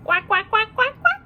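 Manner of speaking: string of playful quacks; duck quacks. Shown as a demonstration. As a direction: quack away like a silly duck. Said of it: "And what do they say?".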